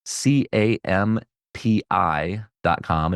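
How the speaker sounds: slow, letter by letter; spelling out slowly. Real, not acted. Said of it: "That's [name]".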